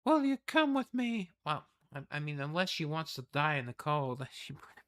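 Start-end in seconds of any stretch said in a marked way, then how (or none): 0.0-1.3 high, wondering noble voice; awed noble lady. Acted. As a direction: marvel at a hidden talent. Reads palace lord's lines.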